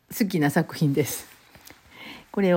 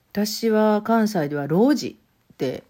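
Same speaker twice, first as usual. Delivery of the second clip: ordinary delivery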